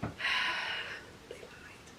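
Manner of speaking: breathy, voiceless whisper after a sigh; bitter whisper. Real, not acted. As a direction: drop to a hushed whisper, still stung. Bitter aside after outburst.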